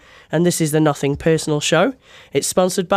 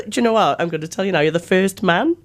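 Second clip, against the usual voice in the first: usual speaking voice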